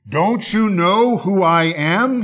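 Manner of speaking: high, slow snarl of outrage; snarling wolf. Acted. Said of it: "he snarled".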